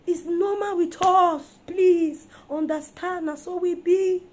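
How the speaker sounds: loud, slow, high mock excuse; mock pleading. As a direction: ham up women's excuse. Mocking women's excuse.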